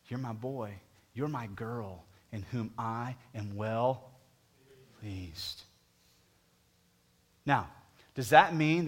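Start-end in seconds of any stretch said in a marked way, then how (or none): 0.1-5.6 soft, slow, intimate voice of God; tender blessing. Acted. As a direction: whisper the blessing to each listener. Soft echo of God's blessing.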